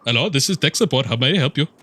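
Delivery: fast, high mock Indian accent call-center voice; hammed-up Indian tech support accent. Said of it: "work on your Indian accent".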